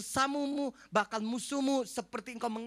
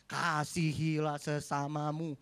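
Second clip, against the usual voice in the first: slow, building louder proclamation; solemn scripture; 6 dB louder, slower than usual. Acted. Pastor closing the sermon with the commandment: slow, building louder proclamation. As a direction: proclaim the commandment slowly, rising.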